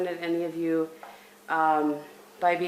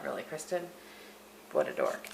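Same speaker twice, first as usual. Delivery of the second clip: self-mocking thought; 3 semitones lower, 13 dB quieter than usual. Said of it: "I thought".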